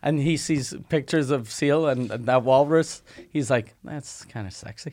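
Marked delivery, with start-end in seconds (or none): 3.8-4.9 low, very soft aside; hushed mock lust. Imagined friend ogling a walrus picture, hushed mock lust. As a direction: murmur it low, as if secretly aroused.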